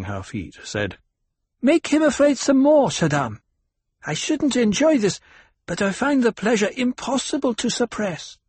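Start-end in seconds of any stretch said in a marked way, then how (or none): 1.6-8.4 put-on high, gleeful child voice; a little girl relishing his fear; young girl voice. A narrator's reading. Alia voiced by narrator.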